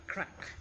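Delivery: usual speaking voice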